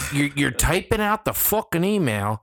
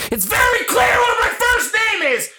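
much higher, loud ranting shout; frustrated shout; 5 dB louder than usual. Real, not acted. Shouting at coworkers who botch his name. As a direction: yell it out, fed up.

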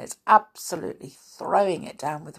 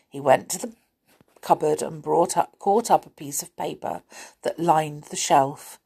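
plain reading voice

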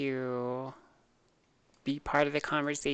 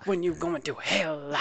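preacher voice; 3 semitones higher, 5 dB louder than usual. Pretend.

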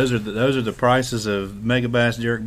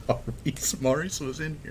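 stifled laugh; 3 semitones higher, 7 dB quieter than usual. Real, not acted.

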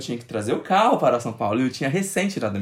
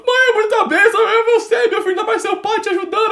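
very high, loud wailing mother voice with a laugh; imitating his mother crying with joy, very high and loud; tearful mother; 7 dB louder than usual. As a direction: voice the crying mom, high and overwhelmed. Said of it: "minha mãe tava chorando".

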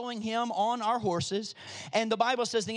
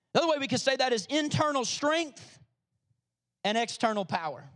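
ordinary delivery

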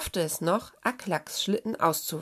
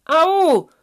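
loud, high yelp; drawn-out "ow" shriek; pained cry; 10 semitones higher, 13 dB louder than usual. A narrator's reading. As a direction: shout out in sudden pain. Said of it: "schrie der Schneehase".

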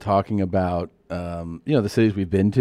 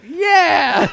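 much higher, louder yell of Yeah!; mock crowd cheer; 6 dB louder than usual. Punchline of the tour joke, whole continent cheering, mock shout. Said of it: "all of Europe was like".

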